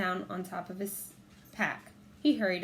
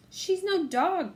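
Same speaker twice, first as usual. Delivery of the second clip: slow, much higher protest; indignant; 11 semitones higher, 5 dB louder, much slower than usual. A narrator's reading. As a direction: object with disbelief. Annie objecting.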